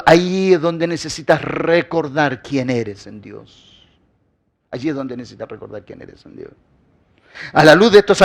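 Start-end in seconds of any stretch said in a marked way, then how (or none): 0.1-3.9 slow, drawn-out preaching line, pitched down; measured, weighty delivery sinking lower; slow emphasis. Real, not acted. Preacher's first, drawn-out take of repeated line. 4.7-6.5 very soft, low and quicker; low murmured echo for reflection; hushed repeat. Real, not acted. Preacher repeats the line in hushed tone.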